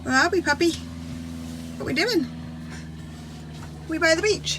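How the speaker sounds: high, sing-song voice for the puppy; talking to a dog. Real, not acted. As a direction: coo at the puppy.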